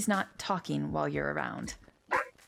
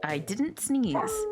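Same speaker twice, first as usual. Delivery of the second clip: drawn out, a bit higher I didn't sneeze; slow weary denial; 6 semitones higher, much slower than usual. Acted. Insisting once more she did not sneeze, slowly. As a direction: sigh it out slowly.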